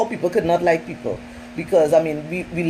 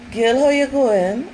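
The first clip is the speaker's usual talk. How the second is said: higher, slow sing-song greeting to a friend; mock caller greeting; 5 semitones higher, slower than usual. Friend calling to cry, lightly voiced slow greeting. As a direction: voice the caller, drawn out and chummy. Acting out a friend's phone call.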